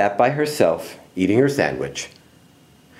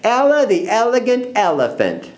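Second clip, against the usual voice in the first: high, mocking name-calling; gleeful playground jeer; gleeful teasing; 8 semitones higher than usual. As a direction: tease with a high singsong taunt. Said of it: "Tiki laughed".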